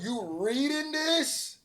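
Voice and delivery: high, drawn-out comic disbelief; incredulous outcry. Real, not acted. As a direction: stretch it out high in exasperation. Incredulous that rapper reads his verse.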